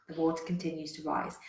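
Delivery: ordinary delivery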